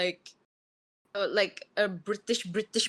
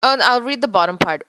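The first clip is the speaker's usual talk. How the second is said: ordinary talk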